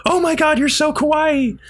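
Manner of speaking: very high, squealing cutesy gush; gushing fangirl. Retold or imitated. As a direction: squeal over something adorable. Nina gushing over cute angel.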